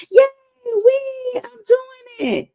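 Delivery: put-on high squealing child on a bike, laughing-crying; a child crowing that they're doing it; excited little kid. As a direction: squeal it like a kid who just learned to ride. Child riding bike, voiced.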